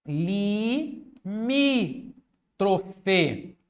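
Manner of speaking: Limítrofe very slowly, syllable by syllable, MÍ held; Limítrofe in separate, drawn-out beats, stress on MÍ; syllable-by-syllable stress demo. Shown as a demonstration. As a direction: stretch Limítrofe into li-MÍ-tro-fe. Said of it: "é a sílaba mais forte".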